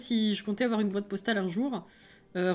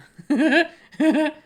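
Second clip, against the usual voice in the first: loud, high real laugh; sudden real laughter; burst of laughter; 6 semitones higher, 10 dB louder than usual. Real, not acted. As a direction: crack up at the irony. Laughs at own irony.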